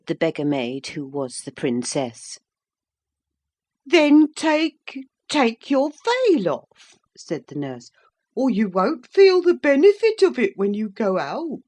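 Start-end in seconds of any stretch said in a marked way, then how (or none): 3.8-7.0 high, slow, louder fussing nurse; old nurse. A narrator's reading. Nurse voiced. 8.3-11.6 put-on old nurse. A narrator's reading. Nurse voiced.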